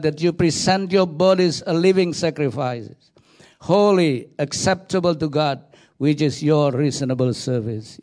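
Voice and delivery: ordinary talk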